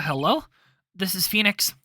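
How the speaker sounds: usual speaking voice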